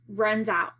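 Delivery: plain reading voice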